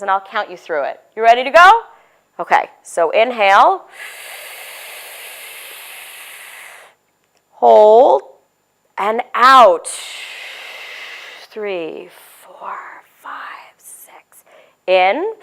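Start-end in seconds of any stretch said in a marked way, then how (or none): 2.4-9.9 slow, calm breathing count; guided breathing. Shown as a demonstration. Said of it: "all of you are my kids in my office". 11.5-14.4 fading to a whisper; whispered count. Shown as a demonstration. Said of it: "all of you are my kids in my office".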